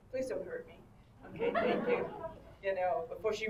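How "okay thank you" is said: high, laughing okay thank you. Retold or imitated. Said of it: "she said".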